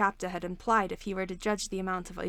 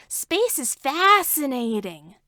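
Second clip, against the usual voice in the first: slow, drawn-out words; stretched out; 4 semitones higher, 7 dB louder, much slower than usual. A narrator's reading. Entrapta savoring the word to Hordak, drawn out. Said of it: "hearing how she stretched the word out".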